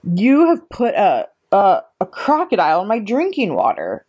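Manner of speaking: acted angry yell; yelled back. A narrator's reading. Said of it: "the Trunchbull yelled back".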